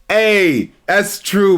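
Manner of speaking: ordinary talk, nothing marked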